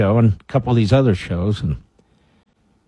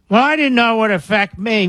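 higher-pitched mock-clueless voter; mock complaining; 8 semitones higher than usual. Host imitating voters who complain about the tax, mocking. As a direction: mimic a whining complainer, pitched up.

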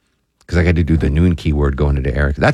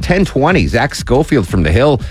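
higher, upbeat radio hand-off into a break; radio host tease; 5 semitones higher than usual. Real, not acted. Host handing to news, weather and traffic, in radio tease delivery.

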